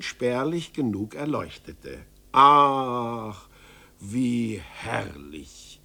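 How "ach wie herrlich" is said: blissful sigh. A narrator's reading. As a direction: savor the relief. Narrator marvels at dry room.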